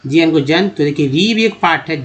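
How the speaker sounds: ordinary delivery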